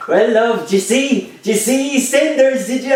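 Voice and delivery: high, loud mock gossip voice; high-pitched mock chatter, played for laughs; gossiping factory woman. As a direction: ham up a nosy coworker asking about the telly. Mimicking chatty factory women.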